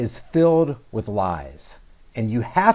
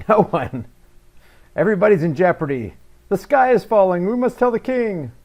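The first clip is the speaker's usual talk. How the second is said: mock panic; 6 semitones higher, 5 dB louder than usual. Mocking the video's panic.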